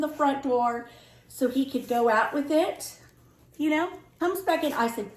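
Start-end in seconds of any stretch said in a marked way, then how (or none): none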